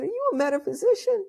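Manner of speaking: high, fast, excited asking; thrilled question. Retold or imitated. Asking the woman at the festival if she is a metaphysician, thrilled. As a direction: blurt it out in delight.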